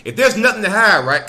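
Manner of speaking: usual speaking voice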